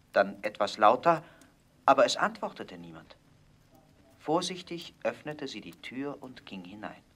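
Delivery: ordinary delivery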